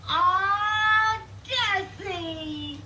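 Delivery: high, drawn-out cry of delight; delighted squeal. Acted. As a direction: gush with joy, stretching it out high. Wife delighted, expecting a surprise.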